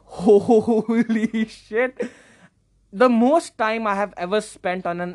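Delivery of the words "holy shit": drawn-out, louder exclamation; stunned disbelief. Retold or imitated. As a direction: stretch it out in astonishment. Acted stunned reaction to his screen time.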